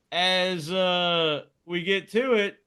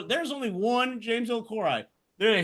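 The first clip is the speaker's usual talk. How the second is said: ordinary delivery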